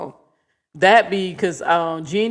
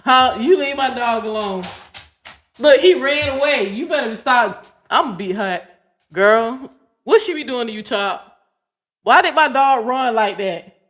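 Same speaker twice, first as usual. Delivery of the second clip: louder, higher, half-joking scolding; loud mock scolding; 4 semitones higher, 7 dB louder than usual. Real, not acted. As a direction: fuss at her for scaring the dog. Dog runs from the partner.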